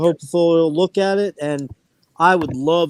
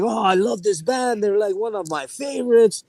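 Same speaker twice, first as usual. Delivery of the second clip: higher-pitched imitation of a French Canadian; French Canadian accent; 7 semitones higher than usual. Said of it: "That's my French Canadian".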